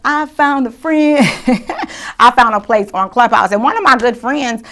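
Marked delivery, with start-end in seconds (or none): 0.0-2.2 said higher through a laugh; amused, laughing repeat. Real, not acted. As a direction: giggle the words out.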